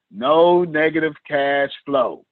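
slow, deliberate chant to repeat; slow call to repeat. Shown as a demonstration. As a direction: say it slowly for the class to echo. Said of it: "Everybody repeat after me".

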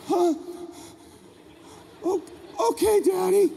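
put-on hesitant, high-pitched child voice; meek little boy. Small son agrees to dad's order, timid. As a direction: give in timidly, stammering and high.

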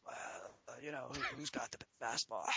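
faint, low rasp, almost gone; hushed laryngitis croak; worsening lost voice. Retold or imitated. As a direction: imitate a failing voice struggling through a question. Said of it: "my voice is getting worse".

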